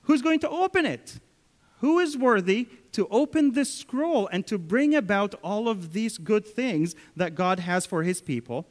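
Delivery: high, loud, ringing call of an angel; angel's loud question. Acted. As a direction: cry out the question like a herald of heaven. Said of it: "the angel asks the obvious question".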